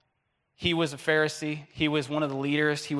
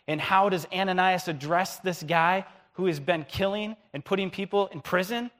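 ordinary delivery

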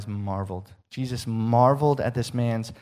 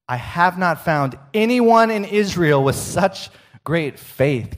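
higher, louder, slow and emphatic; preaching with rising awe; marveling proclamation; 6 semitones higher, 6 dB louder, slower than usual. Acted.

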